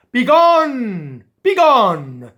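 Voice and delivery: loud, high command; king banishing. Acted. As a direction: drive him off with a roar.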